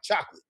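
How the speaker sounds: chocolate clipped to chok-lit; the English squeeze of chocolate. Shown as a demonstration. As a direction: drop the middle vowel of chocolate. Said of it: "that's what it sounds like".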